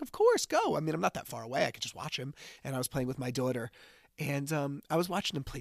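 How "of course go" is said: high, loud cheerful go-ahead; dad happily lets son go, much higher; eager permission. Retold or imitated. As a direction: say it brightly, way up. Dad retelling his reply.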